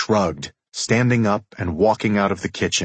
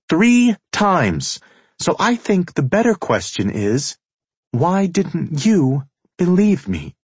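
high, loud woman's voice; heated feminine outburst, emphatic; indignant female character; 7 semitones higher, 4 dB louder than usual. A narrator's reading. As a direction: throw the accusation at him, pitched well up.

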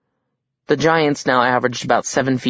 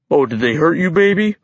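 put-on baby talk, rising high at the end; doting, lilting question; cooing; 4 semitones higher than usual. A narrator's reading. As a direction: fuss over it like a hurt baby. Giant coos over bowling ball.

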